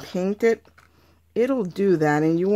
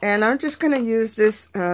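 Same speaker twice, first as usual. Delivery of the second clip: ordinary talk, nothing marked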